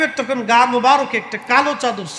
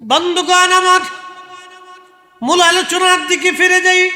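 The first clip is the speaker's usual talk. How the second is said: preaching; 10 semitones higher than usual. Real, not acted. Preacher raising voice to crowd.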